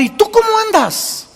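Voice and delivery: high, sharp mock retort; a pastor turning the question on the asker; pointed question. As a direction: fire the question back up high.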